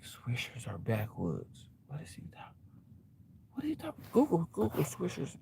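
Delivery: hushed, puzzled whisper; confused whisper. Retold or imitated. Nosy listeners puzzle over his phone talk, whispering.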